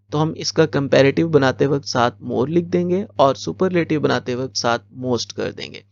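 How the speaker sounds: normal talking voice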